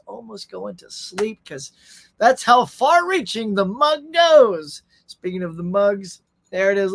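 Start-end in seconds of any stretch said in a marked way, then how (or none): 2.2-4.8 mock grand. Hyping his show's reach.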